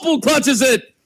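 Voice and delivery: building live play-by-play; esports caster. Acted. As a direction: call the clutch as it happens.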